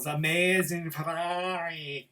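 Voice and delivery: slow, drawn-out mock Italian; Italian accent. Reviewer showing off an accent, hammed-up Italian. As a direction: stretch it out like a hammy Italian. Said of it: "which is a really good accent".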